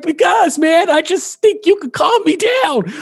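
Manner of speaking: high-pitched mock tearful plea; crying friend. Tripping friend explains why he called her, mock crying. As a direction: imitate a weepy friend pleading. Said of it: "he's crying".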